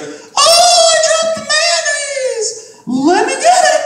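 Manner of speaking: put-on high, sing-song girly squeal; mock a ditzy woman panicking, way up high; ditzy girl voice. Comic mocking a woman who dropped the mayonnaise, high girly squeal.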